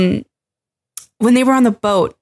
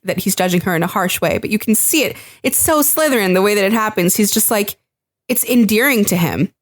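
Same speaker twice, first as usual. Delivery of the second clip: ordinary delivery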